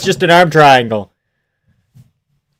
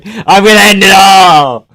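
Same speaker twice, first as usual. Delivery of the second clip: loud, raised cry of exasperation; exasperated shout; 6 semitones higher, 6 dB louder than usual. Real, not acted. Fan despairing over the fight, exasperated shout.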